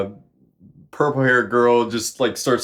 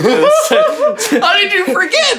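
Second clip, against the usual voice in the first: much higher and louder, slow, laughing it out; 7 dB louder, slower than usual. Real, not acted. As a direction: laugh through the whole question. Laughs at partner forgetting.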